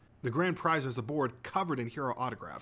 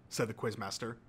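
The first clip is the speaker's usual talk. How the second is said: plain reading voice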